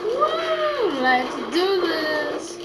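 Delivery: high, drawn-out cheer of excitement; excited whoop. Real, not acted. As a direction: whoop high, pumped to start. Hyping up the next race.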